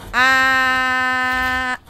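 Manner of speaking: long, held high "ah"; loud sustained cry; loud yell. Real, not acted. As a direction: yell and hold the note. Yells during play.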